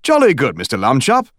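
a light British "Jolly good" with jolly stressed; cheerful British detective; a hint of a British gent; British accent. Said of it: "It also had an accent".

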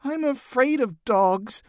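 high, slow, fearful whimper; timid, drawn-out high voice. A narrator's reading. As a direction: whimper like a frightened little girl. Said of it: "whimpered Emily".

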